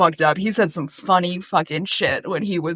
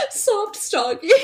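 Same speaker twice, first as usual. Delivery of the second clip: laughing; 12 semitones higher, 3 dB quieter, slower than usual. Real, not acted. Laughing at the phrase.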